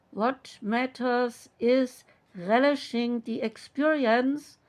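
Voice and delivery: normal talking voice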